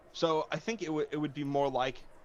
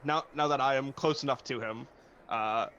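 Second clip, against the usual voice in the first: ordinary delivery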